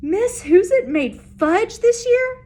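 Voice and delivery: high, loud disbelief; shocked kid blurting out; surprised George. Acted.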